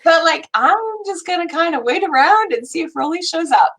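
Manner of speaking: a friend unsure if anyone hears her; quoting a friend. Retold or imitated. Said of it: "she's like".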